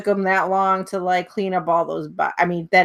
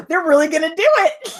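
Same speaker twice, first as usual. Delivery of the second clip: excited; 8 semitones higher, faster than usual. Retold or imitated. Retelling own excitement.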